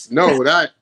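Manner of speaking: usual speaking voice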